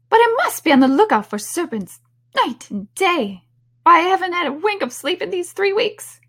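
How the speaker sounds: high, loud fussing bird; aggrieved pigeon. Acted. Pigeon grumbling about hatching eggs, aggrieved. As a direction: squawk it as a put-upon grievance.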